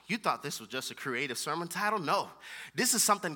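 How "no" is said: ordinary talk, nothing marked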